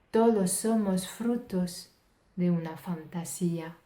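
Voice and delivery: measured Spanish poetry reading, phrase by phrase; poem recitation. Acted. Poet reciting the original Spanish version to show its rhythm, rhythmic recitation. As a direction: recite rhythmically with emphatic key words.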